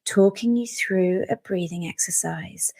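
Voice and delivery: slow, soothing sleep-podcast voice. Acted. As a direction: draw it out gently, as if lulling someone to sleep. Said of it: "speaking in a soothing voice".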